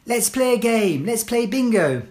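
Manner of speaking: firm, clear classroom instructions; teacher giving commands. Shown as a demonstration. Teacher to pupils entering class, firm clear commands.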